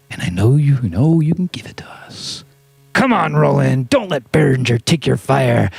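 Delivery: hushed coaxing; pleading whisper. Pretend. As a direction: coax it in a whisper. Whispering plea to Roland.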